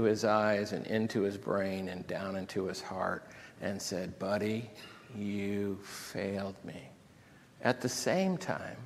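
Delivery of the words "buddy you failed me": hushed, slow, wounded reproach; quiet disappointment that sinks in; penetrating reproach. Acted. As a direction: say it very softly, drawn out. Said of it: "what kind of look Jesus gave".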